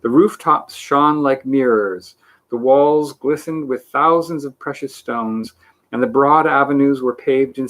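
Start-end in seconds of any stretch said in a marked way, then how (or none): none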